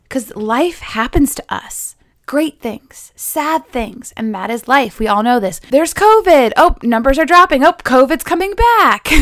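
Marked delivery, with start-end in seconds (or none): 5.7-9.1 higher, louder mock panic; mock alarm. Imitating people alarmed by covid news, higher and louder. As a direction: play the swings of the news.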